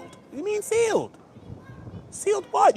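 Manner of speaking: high squeaky disbelieving voice; incredulous squeak; mock disbelief. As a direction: act out the shocked question up high.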